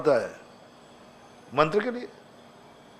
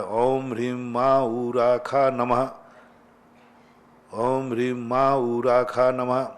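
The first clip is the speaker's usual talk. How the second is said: slow, level intoning, syllable by syllable; mantra recitation; 2 semitones lower, 4 dB quieter, slower than usual. Acted. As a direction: intone it like a sacred mantra, drawing out each sound. (धीमा, सपाट मंत्र-जाप)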